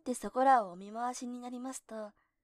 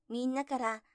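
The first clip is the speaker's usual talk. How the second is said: plain reading voice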